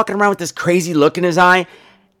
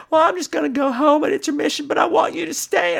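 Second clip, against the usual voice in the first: high, soft partner voice; meek, sheepish request; high and soft, the partner insisting she stays; imitating partner; 10 semitones higher, 3 dB quieter than usual. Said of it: "he said".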